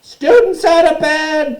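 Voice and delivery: very high, slow, full-force yell; bellowing. Acted. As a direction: bellow the alarm, stretching the words. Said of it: "Peeves bellowed".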